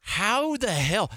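slow, high disbelief; incredulous. Retold or imitated. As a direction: stretch it out in disbelief. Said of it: "I'm like".

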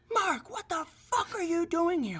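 high falsetto shriek, no extra volume. Retold or imitated. Man retelling girlfriend confronting her ex at night, shrieking. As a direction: imitate her strained, horrified screech. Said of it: "absolutely fucking shrieking".

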